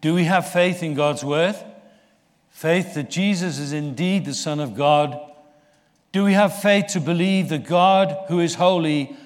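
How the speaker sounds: preaching at full force. Acted. Pastor challenging the congregation, preaching at full force. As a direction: drive the questions home to the church. Closing call to faith.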